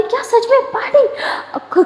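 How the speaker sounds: very high, slow squeal of delight; thrilled squeal. Acted. Younger sister hearing about a party, high excited squeal. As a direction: stretch it out like you can't believe your luck.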